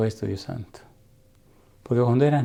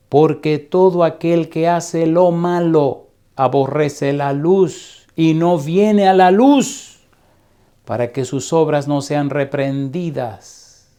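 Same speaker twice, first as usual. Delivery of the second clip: slow, loud pulpit reading; solemn scripture reading; 3 semitones higher, 3 dB louder, slower than usual. Acted. Preacher reading the verse to the church, slow and loud. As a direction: weigh every word of the verse.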